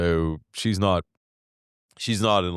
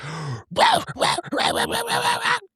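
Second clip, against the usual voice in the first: startled gasp. Retold or imitated. Dog bursts out barking.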